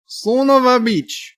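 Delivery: high, slow mock-foreign accent; drawn-out fake foreign accent, maybe Russian; vague foreign accent. Shown as a demonstration. Streamer showing fake ways, high mock-foreign accent. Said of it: "That's, that's foreign".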